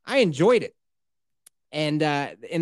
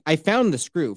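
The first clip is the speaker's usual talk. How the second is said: usual speaking voice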